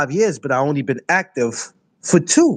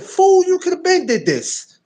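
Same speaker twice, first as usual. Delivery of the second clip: mock self-scolding; 13 semitones higher than usual. Retold scolding himself.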